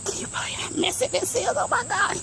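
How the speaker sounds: high, quick, incredulous exclamation; exasperated disbelief. Real, not acted. Gardener shocked at her overgrown beds, high incredulous cry. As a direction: squeal your disbelief at the mess.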